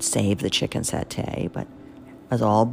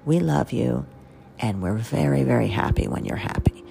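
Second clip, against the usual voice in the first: usual speaking voice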